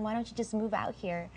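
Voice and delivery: ordinary talk, nothing marked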